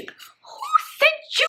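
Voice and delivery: very high, drawn-out squeal; exasperated wail. Retold or imitated. Storyteller bemoaning who sent this man, high exasperated wail. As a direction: stretch each word in a hammed-up high wail.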